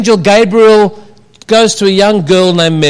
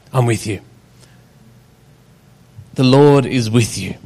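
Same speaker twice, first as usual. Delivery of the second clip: gentle; 8 semitones lower, 5 dB quieter than usual. Pretend. God voiced reassuring Mary.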